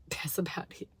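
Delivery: soft unvoiced aside; whisper. Real, not acted. Wrapping up the story to viewers, whispered. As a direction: whisper it to yourself.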